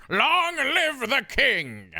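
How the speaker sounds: high, loud, very drawn-out proclamation; theatrical shout, every word stretched; mock triumphant cry. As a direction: cry it out slowly like a hammed-up stage villainess. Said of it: "and then she goes".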